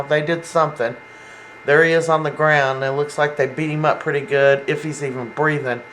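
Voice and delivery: ordinary talk, nothing marked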